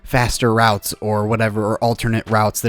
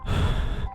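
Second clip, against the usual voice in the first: exasperated sigh; 7 dB quieter than usual. Retold or imitated. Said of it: "And I'm like".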